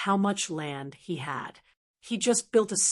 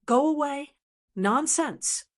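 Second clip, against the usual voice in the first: mild, higher-pitched playful telling-off; amused scolding; 6 semitones higher than usual. A narrator's reading. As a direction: scold lightly, waving off the teasing. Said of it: "Gucci laughed and scolded".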